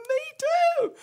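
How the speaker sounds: very high, loud mock squeal; amazed excitement. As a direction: imitate a man thrilled by the coincidence. Homeless man's retold excitement.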